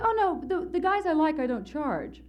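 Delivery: high-pitched mock protest from her; protesting woman.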